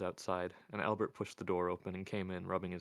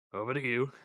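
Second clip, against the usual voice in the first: a mild sneer, pitched a little up; lightly sneered handover; 4 semitones higher, 6 dB louder than usual. A narrator's reading. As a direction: hand it over with a touch of scorn. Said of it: "Albert sneered".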